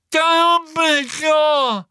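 long, high, loud joyful call; overjoyed shout. Acted. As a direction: shout his name in relief, drawn out. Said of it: "plötzlich hell erfreut". (Neville ruft erleichtert und freudig Dumbledores Namen)

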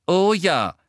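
loud, high, firm confirmation. A narrator's reading. Hermine assuring Harry of the way, in a firm voice. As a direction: answer firmly and emphatically. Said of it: "erwiderte sie mit fester Stimme".

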